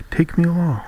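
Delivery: calling out. A narrator's reading. Frog asks the fleeing princess to take him, calling out. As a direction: call after someone leaving, pitch lifted. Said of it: "called the frog".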